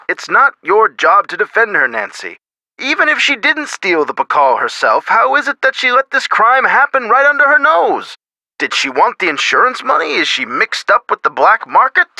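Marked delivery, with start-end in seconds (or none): 2.8-8.2 indignant questioning, climbing higher in pitch; high-pitched, affronted disbelief. Acted. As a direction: press the accusation, voice rising as it goes. Accusing the curator to Nancy.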